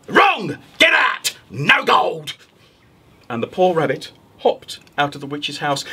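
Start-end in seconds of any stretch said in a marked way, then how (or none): 0.1-2.3 witch snapping. Acted. Witch to the rabbit, cross dismissal. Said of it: "the witch said".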